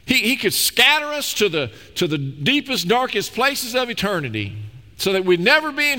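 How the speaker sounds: high-pitched, ringing pulpit delivery; impassioned preaching. Acted. Preacher urging the congregation on God's power and mercy, impassioned. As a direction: preach it with mounting fervour, stretching the key words.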